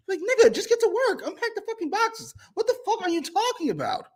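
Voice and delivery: very high-pitched incredulous rant; high squeaky disbelief, half laughing; exasperated mock rant. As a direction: squeal in comic exasperation at a gushing coworker. Mock rant at a gushing coworker.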